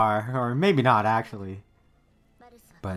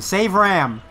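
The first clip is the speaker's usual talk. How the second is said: urgent plea; 5 semitones higher, 6 dB louder than usual. Real, not acted. Urging a character.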